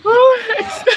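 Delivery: high laughing exclamation. Real, not acted. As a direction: burst out laughing. Laughing at her own yawning.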